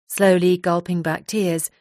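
ordinary delivery

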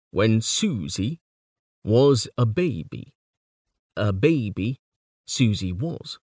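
put-on measured "when Susie was a baby", stressing SU and BA; rhythmic recital, leaning on "Susie" and "baby"; clapping-song beat. Shown as a demonstration. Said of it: "We clap on the stressed syllable".

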